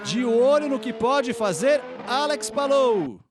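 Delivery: building live play-by-play, rising toward the finish; motorsport commentator. Acted. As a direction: call the lap live, tension building. Live qualifying lap call.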